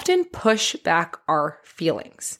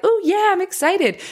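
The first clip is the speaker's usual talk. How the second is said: high-pitched, hammed-up delight; mock excitement; 11 semitones higher than usual. Showing how we greet excitement, mock excited. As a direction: act out being thrilled, much higher than usual. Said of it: "when we feel excitement".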